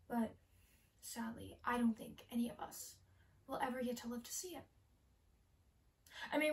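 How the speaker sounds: hushed, halting monologue with long pauses; Elise, pyromaniac. Acted. Pyromaniac confessing her love of fire, hushed and halting. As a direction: softly confide a fascination with fire, lingering between phrases. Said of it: "doing the role of Elise, who is a pyromaniac".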